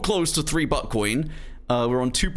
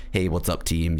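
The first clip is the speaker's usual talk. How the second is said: lower, laid-back late-night gamer voice; relaxed Valorant voice; 8 semitones lower than usual. Shown as a demonstration. Streamer showing chat his low relaxed Valorant voice. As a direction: drop it low and easy like it's one a.m. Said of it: "talking a bit more like".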